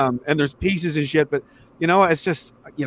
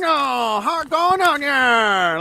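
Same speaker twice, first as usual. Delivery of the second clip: mock Chinese accent; 7 semitones higher, slower than usual. Mocking Chinese comedian greeting Toronto.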